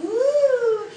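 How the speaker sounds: high, loud "woo" of losing balance; startled whoop. Retold or imitated. Actress tripping on her dress mid-line, startled whoop. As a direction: whoop as if tipping over backward. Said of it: "I went".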